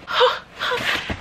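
sharp, high gasp of delight; quick, thrilled intake of breath; excited gasp. Real, not acted. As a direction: gasp at a new find. Discovering more in the bag.

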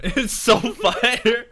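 slow, loud laugh through it; laughing hype. Real, not acted. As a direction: gush while laughing. Laughing hype about Roblox.

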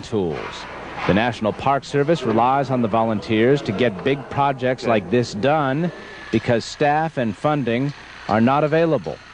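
measured news-report narration; Reportage-Sprecher. Acted. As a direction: read it as a scripted TV feature voice-over. Reads a recorded news report.

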